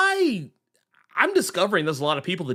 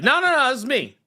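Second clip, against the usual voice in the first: higher, insisting it's all mine; mock innocent claim; 8 semitones higher, faster than usual. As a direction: protest like the name is your own. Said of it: "I'll be like".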